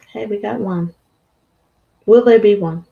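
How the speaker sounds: usual speaking voice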